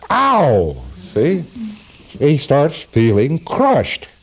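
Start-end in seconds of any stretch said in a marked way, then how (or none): 0.1-0.8 high, drawn-out "ow"; hammed-up yelp of pain; mock pained cry. Subject crying out as the mass presses in, mock pain. Acting subject being crushed.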